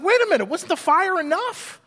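hammed-up wait-what protest; incredulous outburst. Gideon doubts, asks for more proof.

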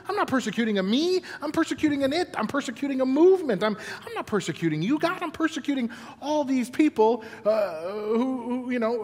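pleading in disbelief, talking over himself; confused protest. Acted. Saul's confused protest to God.

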